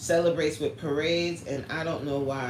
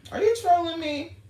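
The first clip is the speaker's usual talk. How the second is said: mock suspicious; 13 semitones higher, 5 dB louder than usual. Real, not acted. Teasing a chat viewer.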